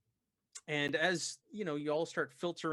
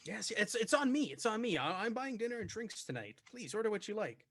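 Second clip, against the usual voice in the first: raised, eager host voice; generous host; 6 semitones higher than usual. Acted. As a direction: insist on paying, lightly raised.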